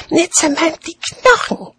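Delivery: put-on high, merry little-kid voice; cheerful child. A narrator's reading. Child relishing the dragon's deeds, cheerful high voice. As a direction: carry on gleefully in a child's high voice. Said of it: "fuhr das Kind fröhlich fort".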